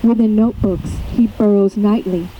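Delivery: deliberate recital with weighted pauses; poem reading. Acted. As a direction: read it as a poem, pausing between phrases. Reads own poem aloud.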